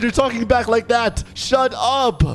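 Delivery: high-pitched angry scolding; yelling teacher. Retold or imitated. Strict teacher telling student to shut up, mock yelling. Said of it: "I'm still getting yelled at".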